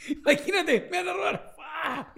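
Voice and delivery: high, hammed-up frightened cry; mock panic. Friend imagining being robbed, high mock-panicked cry. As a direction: play a victim yelping in pretend terror. Acts out someone about to be robbed.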